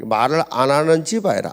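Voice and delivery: ordinary delivery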